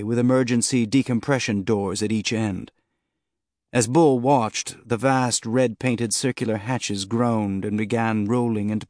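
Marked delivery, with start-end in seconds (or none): none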